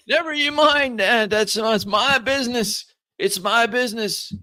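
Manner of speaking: put-on high, loud, agitated old man; defensive old man. Old man refusing to explain package, defensive shout. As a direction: protest loudly to guard your secret.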